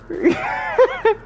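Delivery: very high squealing laugh; shrieking laugh. Real, not acted. Streamer shrieks with laughter at the chat.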